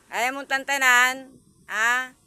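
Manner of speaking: slow, loud telling-off of the cat; loud slow scold. Real, not acted. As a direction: call the cat out sharply.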